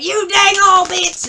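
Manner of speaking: high, very loud cussing holler; hammed-up insult shout, drawn out; imitated shouting. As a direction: yell it like a feuding spouse across the store. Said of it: "shouting at each other cuss words".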